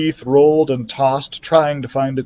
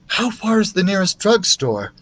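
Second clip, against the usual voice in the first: worried mother; 7 semitones higher than usual. A narrator's reading. Mother voiced.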